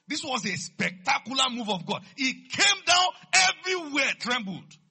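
high shouted proclamation, building; thunderous pulpit climax; full-force preaching. Real, not acted.

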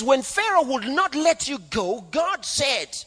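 high-pitched, drawn-out preaching; declaimed divine warning. Acted. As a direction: proclaim God's threat to Pharaoh. God's warning to Pharaoh, acted.

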